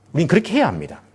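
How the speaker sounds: normal talking voice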